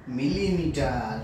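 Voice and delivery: 2 drawn out very slowly for pupils to repeat; 3 say kilometre syllable by syllable, very slowly; 1 slow dictation. Shown as a demonstration. 4 teacher reading kilometre aloud for pupils to copy, very slow. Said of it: "উচ্চারণ করে শোনাবো".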